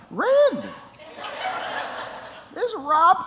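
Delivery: squeaky high Buddy voice straining against a sore sinus; Buddy impression. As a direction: pitch way up and do Buddy. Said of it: "Okay, I'll try it".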